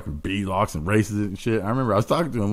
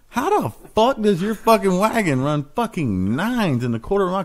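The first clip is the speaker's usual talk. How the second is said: slowed, higher, stunned disbelief; incredulous retelling; 8 semitones higher than usual. Retold or imitated. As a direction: drag it out like you can't believe it. Retelling his own disbelief.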